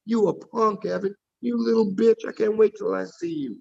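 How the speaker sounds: high-pitched, heated threat; worked-up trash talk, pitched high; angry taunting. Real, not acted. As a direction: hurl it as an angry challenge. Real heated argument, threatening Evan.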